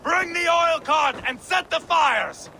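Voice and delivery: high-pitched order called to the troops; urgent battlefield command; shouted command. Acted. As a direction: call out the order so every warrior hears.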